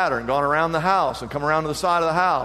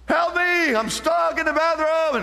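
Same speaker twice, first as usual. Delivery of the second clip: put-on panicked child cry; 12 semitones higher, slower than usual. Locked-in toddler calling his mama, higher panicky cry. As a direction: cry out like a scared little boy stuck behind a door. Frightened three-year-old locked in bathroom.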